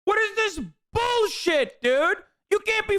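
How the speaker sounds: high and slow, drawn out in outrage; angry disbelief. Real, not acted. Streamer ranting at the video, slow outrage. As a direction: stretch it out, incensed.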